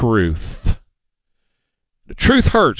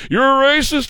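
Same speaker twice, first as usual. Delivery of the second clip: mocking accuser; 10 semitones higher than usual.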